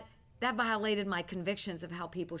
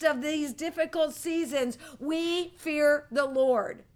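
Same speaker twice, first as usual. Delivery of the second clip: slow emphatic; 5 semitones higher, 6 dB louder, slower than usual. Acted. Preacher slowing for emphasis.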